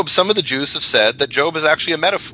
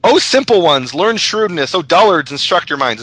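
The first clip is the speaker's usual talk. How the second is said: higher, louder appeal to the crowd; proclaiming scripture; 5 semitones higher, 3 dB louder than usual. Acted. Wisdom addressing the simple ones, calling out. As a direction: call out the verse as Wisdom.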